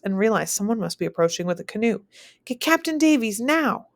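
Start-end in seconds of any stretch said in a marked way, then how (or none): 2.5-3.9 urgent, higher shouted order. Acted. Messenger arriving at the fort, urgent shout. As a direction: bark it out urgently. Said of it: "The shout is closer".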